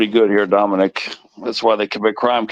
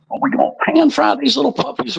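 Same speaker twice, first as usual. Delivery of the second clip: playful high voice. Real, not acted. Playful cooking talk to viewers.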